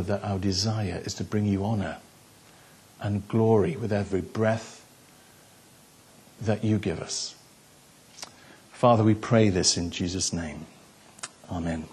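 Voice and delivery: soft, low prayer voice; hushed closing prayer to the Father. Acted. Pastor praying quietly before the sermon, soft and low.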